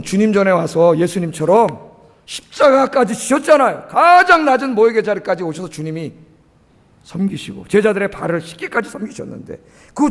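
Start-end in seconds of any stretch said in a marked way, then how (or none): none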